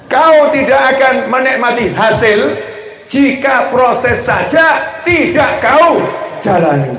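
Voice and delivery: a slow, lifted recitation of the motto; slow recited motto. Shown as a demonstration. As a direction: spell out the saying slowly and solemnly. Repeating motto slowly.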